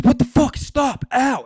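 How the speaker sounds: high, loud cries of shock and pain; friend's panicked shout as cops grab him; retold pained yell. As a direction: yell it like you're being dragged from the car. Marty yanked out by cops.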